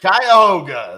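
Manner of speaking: exclaiming. Real, not acted. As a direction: sing out Cuyahoga, drawn and delighted. Repeats the county name back loudly.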